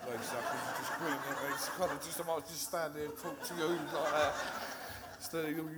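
nervous laughter breaking through the words; laughing. Real, not acted. Nervous speaker to a grinning Lisa in the audience, laughing.

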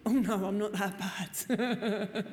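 slow, laughing mock protest; oh no, not me, laughing it off; laughing denial. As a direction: brush it off with a half-laugh.

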